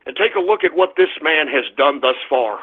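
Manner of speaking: ordinary talk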